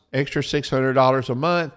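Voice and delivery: usual speaking voice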